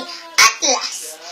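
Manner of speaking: ordinary talk, nothing marked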